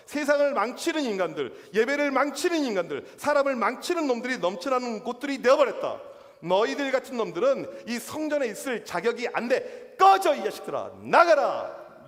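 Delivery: loud, indignant rebuke building to an outburst; angry denunciation. Acted. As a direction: denounce them, then order them out loudly. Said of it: "예수님께서 그렇게 화가 나면서". (화가 나서 호통치며)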